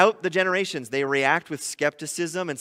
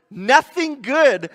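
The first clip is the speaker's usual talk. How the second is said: scoffing; 7 semitones higher, 8 dB louder, much slower than usual. Acted. Mocking Nazareth, slowed and raised.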